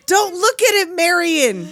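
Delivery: high, loud hammed-up cry; mock urgent warning. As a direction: call out a melodramatic warning. Mock film quote, warning cry.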